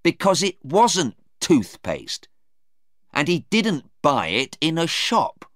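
a touch of hot anger; angry. Acted. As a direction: push back hard at the lawyer. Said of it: "Stephen said angrily".

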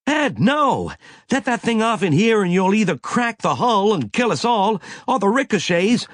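high, loud desperate warning; alarmed shout. A narrator's reading. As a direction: cry out to stop him. Captain stops armed man.

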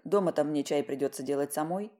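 ordinary delivery

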